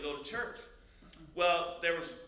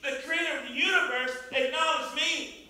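loud, high, impassioned testimony; ringing pulpit delivery, pitched up; full-force preaching; 7 semitones higher, 8 dB louder than usual. Acted. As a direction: proclaim it to the whole congregation.

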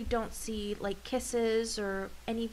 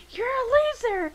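very high, loud mocking kid's yell; imitated yelling; 13 semitones higher, 6 dB louder than usual. Podcast guest imitating Yurio insulting a rival, high yelling. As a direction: hurl it like a yelling brat, pitched way up. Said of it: "the kid is fucking yelling".

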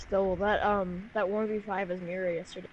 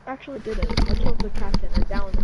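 loud defensive; 12 dB louder than usual. Real, not acted. Defending himself loudly.

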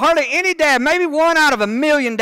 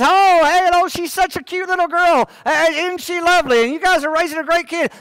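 put-on preacher at the IHOP gushing over the toddler; 6 semitones higher than usual. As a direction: gush over the little girl like a cheery preacher. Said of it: "and he says".

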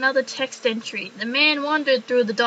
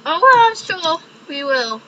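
ordinary talk, nothing marked

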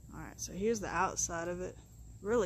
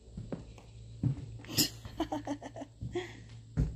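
airy, high giggle under the breath; breathy laugh; 8 semitones higher, 4 dB quieter than usual. Real, not acted. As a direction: let a quiet, breathy laugh slip out. Laughs at the wrecked house.

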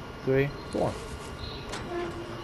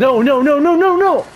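rising frantic protest; quick panicked no's; 7 semitones higher, 4 dB louder than usual. Real, not acted.

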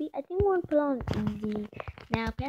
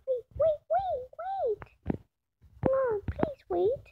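high, thin pleading; pleading wait; 12 semitones higher, slower than usual. Real, not acted. Player begs a wandering puppy to wait, high pleading. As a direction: beg the puppy to stay put.

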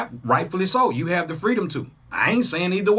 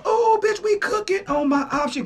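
much higher, gleeful squeal of a win; happy as hell; 14 semitones higher than usual. Retold or imitated. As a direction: celebrate like the trade just hit. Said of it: "I was happy as hell".

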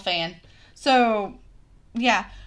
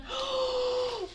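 excited gasp; 11 semitones higher, 9 dB quieter than usual. Real, not acted. Unboxing her dream Minnie ears.

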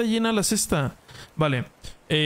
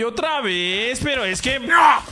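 frustrated shout; 6 semitones higher, 4 dB louder, slower than usual. Real, not acted. Mobs keep attacking his base.

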